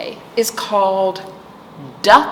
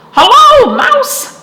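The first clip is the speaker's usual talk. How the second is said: very high, loud duck hello; Duck's greeting; 8 dB louder, slower than usual. A narrator's reading. Storyteller voicing Duck greeting Chicken, very high and loud. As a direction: squeak it out brightly as Duck.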